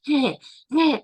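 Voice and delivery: teasing imitation of loud breathing; playful mock of heavy breathing; mocking breath noises. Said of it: "my daughter started to mock me going".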